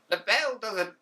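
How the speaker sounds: put-on an old school English teacher scolding pupils; better teacher voice. Shown as a demonstration. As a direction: play the strict old English teacher properly. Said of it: "gonna do a better English, uh, English teacher voice".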